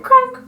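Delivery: high, loud imitated crack; crack sound. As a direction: mimic a cracking back.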